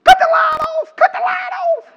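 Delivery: high, mock-excited shout of a teasing wife; wife's teasing shout. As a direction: tease him loudly, shrieking to cut the lights. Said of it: "Brandy looked over at me and she said".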